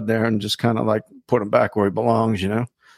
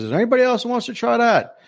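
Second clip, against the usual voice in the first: higher-pitched call to the crowd; host's challenge; 11 semitones higher than usual. Retold or imitated. Bar host seeking another challenger, high calling voice. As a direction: throw the challenge out to the room. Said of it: "He goes".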